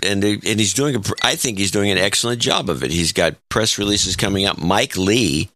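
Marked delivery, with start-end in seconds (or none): none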